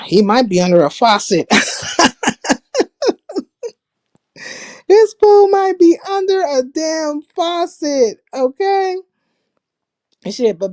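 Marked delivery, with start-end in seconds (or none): 1.5-3.4 high-pitched burst of laughter. Real, not acted. Laughing at guest's bad audio. 4.9-9.0 high, slow, laughing through words. Real, not acted. Laughing at guest's bad audio.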